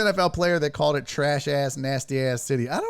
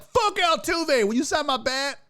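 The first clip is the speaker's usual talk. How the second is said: mock fan voice; 9 semitones higher than usual. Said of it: "they're all like".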